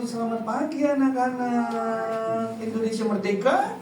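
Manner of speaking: drawn-out, sing-song classroom greeting; slow teacherly call ending on a rising question; schoolteacher greeting the class. Acted. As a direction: stretch it out like a teacher to children. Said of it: "Begitu dia jadi guru".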